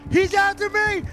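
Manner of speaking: high, loud panicked cry; panicking gamer. Real, not acted. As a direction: yell it in sheer panic. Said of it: "chased by killer, panicking".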